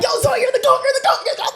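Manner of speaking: very high-pitched hype chant; breathless-fan style hyping, played up; ecstatic cheering. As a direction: imitate friends squealing praise. Friends celebrating in elevator, acted out.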